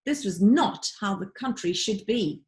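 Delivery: ordinary delivery, nothing marked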